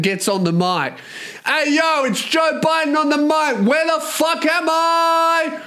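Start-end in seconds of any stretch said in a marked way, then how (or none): 1.4-5.6 high-pitched hype man shout; Joe Biden. Acted. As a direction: hype up the crowd, drawing out the last line. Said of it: "imagine if Joe Biden was a fucking hype".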